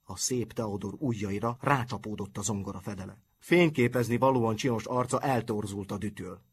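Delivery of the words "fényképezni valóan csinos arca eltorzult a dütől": usual speaking voice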